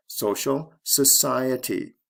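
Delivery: SOcial, then a slow soCIety; shifting stress. Shown as a demonstration. Teacher giving the next example, stress moving to second syllable in society. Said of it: "Stress goes from the first to the second syllable".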